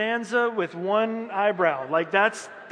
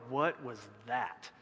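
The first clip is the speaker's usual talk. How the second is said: stunned; 6 semitones lower, 7 dB quieter, slower than usual. Retold or imitated. Stunned congregation after bombed sermon.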